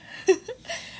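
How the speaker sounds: a short high laugh at herself; amused giggle after a mock wail; real laugh. Real, not acted. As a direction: laugh at your own dramatics. Laughs at own retold cry.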